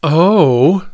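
ordinary delivery

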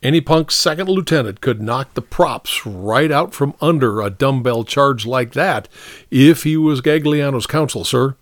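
lightly voiced sergeant, respectful but insistent; frank sergeant. Sergeant telling the captain the charge won't stick, mildly insistent. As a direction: argue firmly with a superior.

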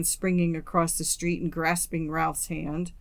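plain reading voice

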